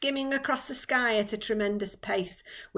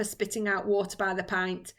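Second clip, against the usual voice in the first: plain reading voice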